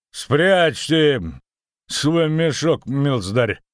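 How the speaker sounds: high, loud, slow threat; menacing. A narrator's reading. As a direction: warn him off sternly. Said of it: "грозно произнёс будущий сотник".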